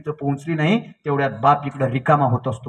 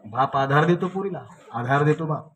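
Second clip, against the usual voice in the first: usual speaking voice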